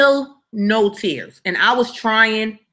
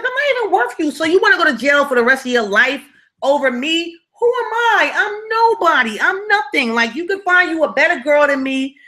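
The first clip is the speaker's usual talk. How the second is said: pleading; 7 semitones higher than usual. Retold or imitated. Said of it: "I was pleading for my life".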